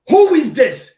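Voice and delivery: high, loud demand; indignant yell. Retold or imitated. Firefighter yelling back at unseen voice on the stairs. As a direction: snap back who's talking to you. Retold demanding who's shouting.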